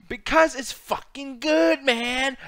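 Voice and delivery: high, loud, stretched-out insistence; slow, emphatic comeback; heated defense. Real, not acted. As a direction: argue back with full conviction. Defends pick in argument.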